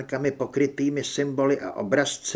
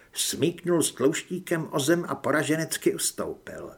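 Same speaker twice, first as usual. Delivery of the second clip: plain reading voice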